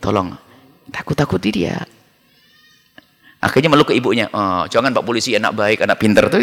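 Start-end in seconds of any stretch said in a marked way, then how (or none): 0.0-1.8 softer and lower, a hushed aside; whispering to policeman. Acted. Mother secretly asks the policeman to scare the child, hushed and low. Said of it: "langsung bisik-bisik kepada pak polisi". 4.3-6.4 soothing mother. Acted. Mother soothes frightened child.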